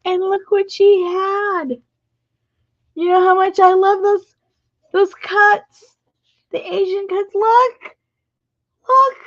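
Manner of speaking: very high, loud squealing delight; thrilled. Real, not acted. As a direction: show off the treasure, squealing. Showing off the gifted paper cuts.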